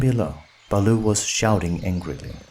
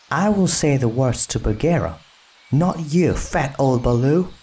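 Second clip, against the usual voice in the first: said crossly; 5 semitones higher than usual. Acted. Mowgli snubbing Baloo, cross and higher. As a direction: snap it at the old bear. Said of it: "he said crossly".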